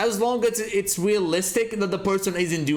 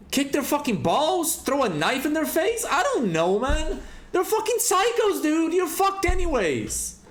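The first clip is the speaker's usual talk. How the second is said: worked-up rant; 10 semitones higher than usual. Real, not acted. Ranting about passive horror victims.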